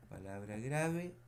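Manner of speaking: ordinary talk, nothing marked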